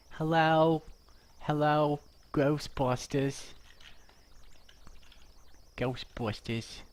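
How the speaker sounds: high, slow put-on New York receptionist; high, slow imitation of the Ghostbusters front desk girl; New Yorker receptionist impression. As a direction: drawl it out like the movie receptionist. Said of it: "She talks like this".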